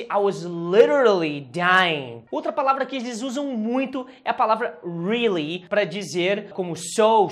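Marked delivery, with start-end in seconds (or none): 0.0-2.2 slow, loud emphasis on "literally"; stress on LITERALLY. Shown as a demonstration. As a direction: punch and stretch "literally" for exaggeration. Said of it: "Quando eu quero dar essa ênfase".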